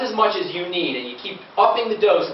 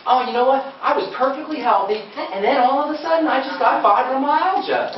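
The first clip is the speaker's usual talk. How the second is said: higher-pitched imitation of a baffled caller; mock innocent surprise, pitched up; mimicked complaining patient; 6 semitones higher than usual. Imitating people blaming sudden illness, high mock-innocent voice. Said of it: "they'll say to me things like".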